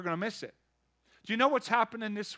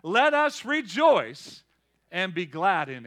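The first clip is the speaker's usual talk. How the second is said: reading; 5 dB louder, slower than usual. Acted. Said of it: "It says".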